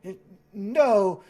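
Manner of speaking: hesitant correction from a shy ghost; nervous ghost. Acted.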